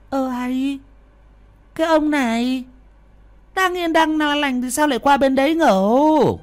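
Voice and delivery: a puzzled wife scolding her husband; bewildered wife. A narrator's reading. Wife asking her husband why he sleeps elsewhere, high and indignant. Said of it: "hóa đá ngơ ngẩn".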